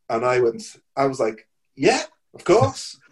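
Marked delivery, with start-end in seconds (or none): 1.8-3.0 eager, overkeen yes. Retold or imitated. Acting cool about band offer.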